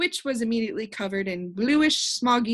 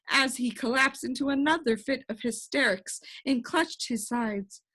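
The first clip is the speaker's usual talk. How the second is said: plain reading voice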